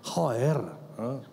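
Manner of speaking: imitating a Spanish friend's Castilian Spanish accent; Spain Spanish accent. Businessman friend pleading with the pastor, in a mock Castilian Spanish accent. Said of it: "Y él se agarraba".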